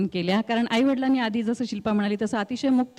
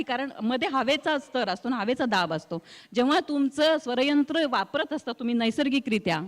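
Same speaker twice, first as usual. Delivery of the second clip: ordinary talk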